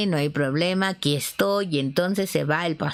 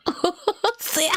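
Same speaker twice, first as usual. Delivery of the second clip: high laughter through words; real laugh; 10 semitones higher than usual. Real, not acted. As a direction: laugh while speaking. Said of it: "laughing at story".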